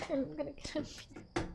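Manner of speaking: laughing murmur. Real, not acted. Laughing aside.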